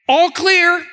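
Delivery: projected all-clear, re-enacted; retold call-out. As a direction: call it out down the line. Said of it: "the helper would yell back".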